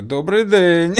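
high, loud bright hello; cheerful Ukrainian greeting. Retold or imitated. Greeting a shop cashier in Ukrainian, loud and high. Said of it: "ты сам уже такой".

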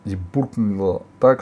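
plain reading voice

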